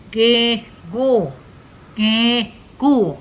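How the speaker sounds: pinched nose, blocked nasal sound; holding nose. Shown as a demonstration. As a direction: say it with your nose held shut. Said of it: "Hold your nose and say".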